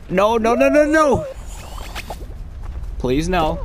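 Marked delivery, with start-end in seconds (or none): 0.1-1.2 higher, louder no, no, no; panicked yell. Real, not acted. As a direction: shout it out in alarm. Panicking in the race.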